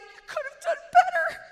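high, loud tearful mock wail; mock crying. Preacher imitates herself crying at mirror, mock sobbing. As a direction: blubber it as if egging on your own tears. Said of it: "to make myself cry more".

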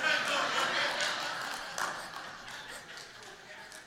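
high airy laugh at his own joke; high breathy cackle. Real, not acted. Preacher cracking up at his own joke, high airy laugh. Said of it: "I like my own jokes".

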